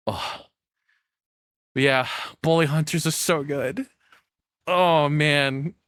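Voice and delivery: usual speaking voice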